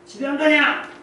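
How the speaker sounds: high, loud scolding yell; acted angry shout. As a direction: play a fed-up neighbour shouting at kids. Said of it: "그 소리를 지를까?". (높고 크게 꾸짖는 고함 연기)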